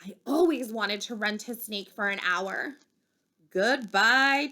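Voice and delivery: usual speaking voice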